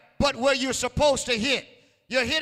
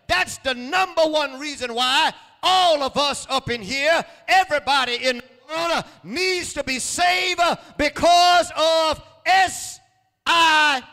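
loud, higher, spelling out "S I N" letter by letter; preaching shout; 5 semitones higher, 7 dB louder than usual. Acted. Preacher spelling sin to the congregation, shouting. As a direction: hammer each letter home.

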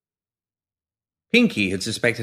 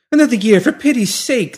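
high, loud plea; high-pitched desperate appeal; pleading; 14 semitones higher, 7 dB louder than usual. A narrator's reading. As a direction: beg for mercy, voice pitched right up. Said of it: "the woman pleaded".

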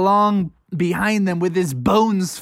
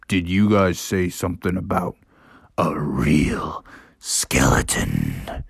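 low, slow spooky whisper; low whispering father bear; mysterious father whisper; 10 semitones lower, slower than usual. A narrator's reading. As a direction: hush it like a spooky secret.

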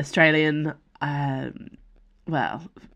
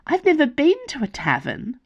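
bright, much higher burst of wonder; eager delight; 10 semitones higher than usual. Acted. Nimue to Calix about taverns, eager and excited. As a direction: squeal it like a sheltered girl begging for adventure. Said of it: "Nimue clapped".

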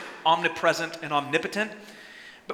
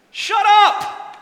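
much higher and louder, a commanding shout; sharp shout; 12 semitones higher, 9 dB louder than usual. Acted. Jesus silencing the demon, loud commanding shout. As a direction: bark the order to silence him. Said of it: "He says".

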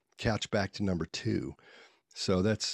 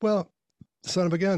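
much higher, louder amazed exclaim; 9 semitones higher, 4 dB louder than usual. Retold or imitated. A boy amazed at his father's guess coming true, excited. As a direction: burst out like it's magic.

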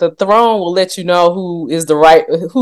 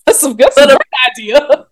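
meme lady; 5 semitones higher than usual. Retold or imitated. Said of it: "the next one's like".

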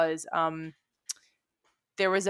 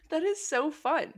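laughing; 9 semitones higher, slower than usual. Real, not acted. Laughing at friend's accent trivia.

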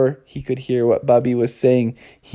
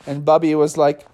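plain reading voice

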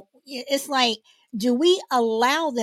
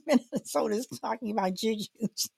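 talking through real laughter; words breaking into giggles; laughing; faster than usual. Real, not acted. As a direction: try to explain while cracking up. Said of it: "kinda j-, uh, laughing here".

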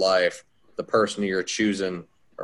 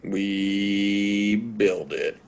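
dramatic tagline; much slower than usual. Acted. Finishing the sponsor's slogan.

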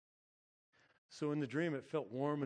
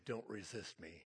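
lightly voiced inner voice, low and soft; mystical voice; 4 semitones lower, 6 dB quieter than usual. Inner voice to the panicking man awake, softly mystical. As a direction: drop softly into the voice from the dream. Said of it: "I feel that voice say".

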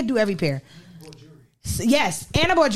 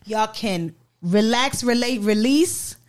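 slow catchphrase; 2 semitones higher, much slower than usual. Pretend. Quoting show catchphrase slowly.

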